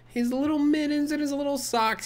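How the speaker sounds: high cooing over tiny clothes. Real, not acted. Streamer coos over the baby's tiny clothes, high and sweet.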